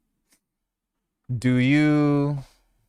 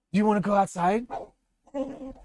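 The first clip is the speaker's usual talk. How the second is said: excited dog talk; 11 semitones higher than usual. Real, not acted.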